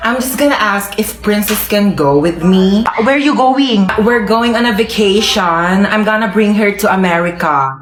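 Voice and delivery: slow American English; American accent. Acted. American mother asking to take the girl on vacation, slow American accent. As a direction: speak like an American guest.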